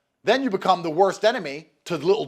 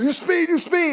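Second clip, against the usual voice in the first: high, louder mock police shout; hammed-up raid yell; 11 semitones higher, 4 dB louder than usual. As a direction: bark it like a cop bursting through the door.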